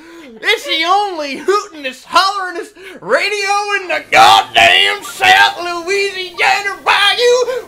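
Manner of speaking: loud, drawn-out country DJ shouting. Acted. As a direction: hype it like a backwoods radio ad. Hick radio ad parody.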